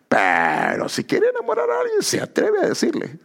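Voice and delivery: very high playful voice; mock falsetto. Preacher teasing shy men in love, high falsetto. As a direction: squeak it out teasingly.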